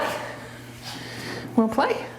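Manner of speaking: whisper, drawn out slowly; whispered coaxing. Real, not acted. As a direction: coax the dog softly in a slow whisper. Coaxing the dog to play.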